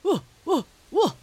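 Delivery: loud, high "woo" barks; three short dog barks, calling from afar; dog bark ringing out. A narrator's reading. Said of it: "rang out on the still morning air".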